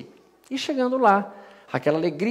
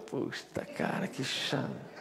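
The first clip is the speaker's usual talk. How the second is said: sussurro assustado; 3 semitones lower, 13 dB quieter, slower than usual. Retold or imitated. Medo no alto do avião.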